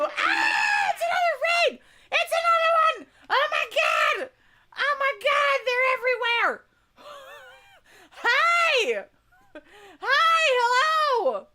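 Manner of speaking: high shrieking, loud raid welcome; excited shrieking. Real, not acted. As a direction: squeal at the top of your voice. Second raid arrives, shrieking welcome.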